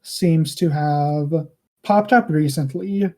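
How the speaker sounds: halting, measured delivery with pauses; lightly voiced ruler weighing each word; restrained ruler. Ruler on his throne addresses the ambassadors of Waymill, restrained. Game master speaks as ruler.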